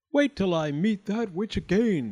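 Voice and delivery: high, indignant declaration; declaring. A narrator's reading. He threatens to confront the witch, declaring indignantly. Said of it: "he declared".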